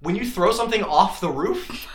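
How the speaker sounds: normal talking voice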